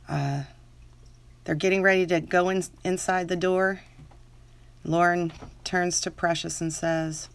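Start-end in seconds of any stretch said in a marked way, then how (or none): 1.6-7.3 story reading. Acted. Reads fan story in character.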